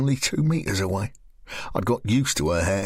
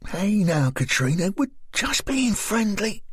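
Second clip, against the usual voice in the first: put-on higher, friendly young woman's voice; higher, coaxing woman's voice; Lisa's voice; 10 semitones higher than usual. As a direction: coax a strange girl gently. Narrator voices Lisa.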